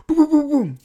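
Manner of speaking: very high punchy booms; hands shooting up. Retold or imitated. Storyteller miming hands going up, punchy booms. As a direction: pop each boom out. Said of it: "the rest of the five went up".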